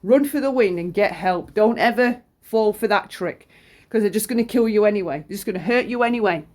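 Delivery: normal talking voice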